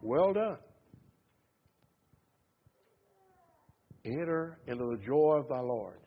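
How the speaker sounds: soft, tender welcome home; gentle welcome. Acted. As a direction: softly welcome the faithful into joy. God welcoming the faithful home.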